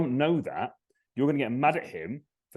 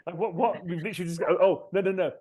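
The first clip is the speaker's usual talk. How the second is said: high-pitched, pushy tiger partner, clearly lifted; bubbly tiger wife refusing to drop the subject; tiger partner pushing; 6 semitones higher than usual. Retold or imitated. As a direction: mimic an exasperated partner pleading to keep talking. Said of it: "She's like".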